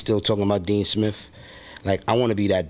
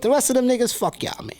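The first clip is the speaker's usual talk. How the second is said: much higher, aggrieved put-on rapper voice; mad rapper persona. Rapper alter ego complaining to interviewer, high put-on voice. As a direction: gripe like a bitter, neglected rapper stranded alone. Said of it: "from the perspective of the mad rapper".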